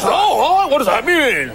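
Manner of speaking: high, puzzled voice of a baffled listener; puzzled people. Retold or imitated. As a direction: play the confused public, pitched well up. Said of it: "People, people hear it".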